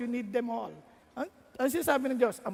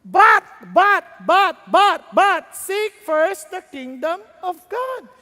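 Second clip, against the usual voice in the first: much louder, stuttered build into slow scripture; loud proclamation; 8 semitones higher, 12 dB louder, much slower than usual. Acted. Preacher thundering a Bible verse to the church. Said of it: "Kasi sabi ng Bible".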